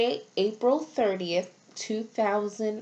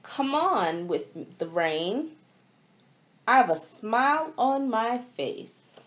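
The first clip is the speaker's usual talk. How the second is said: put-on reading to children. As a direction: read each line like a picture book page to a class. Reads storybook aloud to children.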